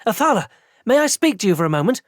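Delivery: panting after running; breathless from hurrying after someone; out of breath. A narrator's reading. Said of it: "came panting after me".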